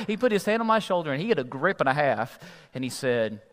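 normal talking voice

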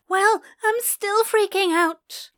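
high, loud, anxious admission; freaking out. Acted. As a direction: blurt out the panic. Admits panic to friend.

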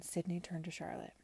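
usual speaking voice

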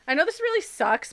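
normal talking voice

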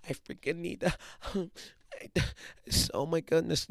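a hammed-up athlete whining that he's sick; mock panicky whiner. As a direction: pretend to fuss and panic over being sick, breaking into a laugh. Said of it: "He didn't be like".

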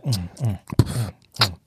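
low hammed-up moans; mock moaning. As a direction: moan low like a lover. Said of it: "Three, two, one, action".

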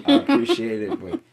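laughing through the words; words break into laughter; trying not to laugh. Acted. Acted quote, laughing through it.

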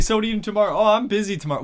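high, loud retold back-and-forth; mock exchange. Acts out the couple's tense exchange.

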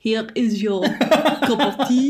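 slow, loud mock French accent; hammed-up bad French accent, amused; weird French accent. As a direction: mangle it in a drawn-out French accent. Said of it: "she was a weird French accent".

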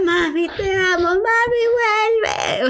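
retelling herself crying for mom to return; crying plea. Retold or imitated. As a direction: sob the words as if breaking down. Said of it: "Y yo llorando".

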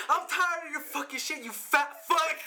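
high, loud mock yell of a fed-up kid; furious yell. Bullied classmate finally snaps at his tormentor, high furious mock yell. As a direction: yell it like you've finally snapped. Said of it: "he got so pissed".

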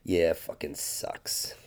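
low, soft, slow and weary; muttered, slow resignation; tired mumble. Retold or imitated. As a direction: drag it out like he's just worn down. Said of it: "he acknowledges it and says".

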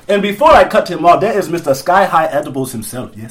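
mock-formal African-accented introduction; put-on African accent, ending on a tag question. Host presenting the next guest, put-on African accent. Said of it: "talk with an African accent".

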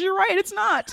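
high-pitched mock agreement. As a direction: push the pitch way up, imitating her.

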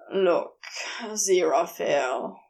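low, heavy opener; low, reluctant Crowley; letting him down. Acted. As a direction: brace him for bad news.

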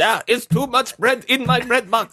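high, slow, pounding German accent; passionate German. Shown as a demonstration. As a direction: pound each word like a fist. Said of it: "that's not what you wanna do".

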